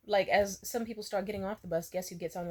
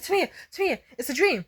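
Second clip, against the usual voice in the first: put-on worried boyfriend; 8 semitones higher, 4 dB louder, faster than usual. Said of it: "He was like".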